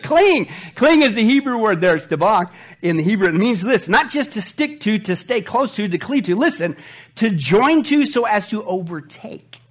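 normal talking voice

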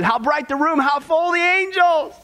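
high, slow wondering voice; awestruck vision. Retold or imitated. Dying woman seeing angels, high and awed. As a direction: marvel at what you see.

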